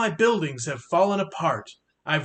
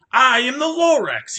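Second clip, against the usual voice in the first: lightly voiced Lorax, louder and higher with a falling end; 6 semitones higher, 7 dB louder than usual. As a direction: announce yourself as the gruff little Lorax. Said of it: "he coughed and he whiffed".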